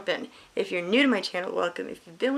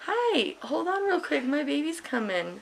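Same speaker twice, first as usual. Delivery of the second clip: delighted greeting; 7 semitones higher, slower than usual. Real, not acted. Greeting her son.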